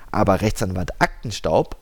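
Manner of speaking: plain reading voice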